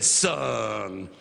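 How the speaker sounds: put-on old pastor. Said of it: "He said".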